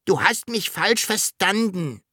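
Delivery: high small dragon voice; little dragon correcting. A narrator's reading. Dragon to the mistaken Sams, high correcting voice.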